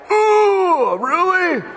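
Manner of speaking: high squeaky ooh, really; hammed-up shock at being caught out; mock shocked. Caught-out men reacting to Jesus's teaching, mock shocked squeal.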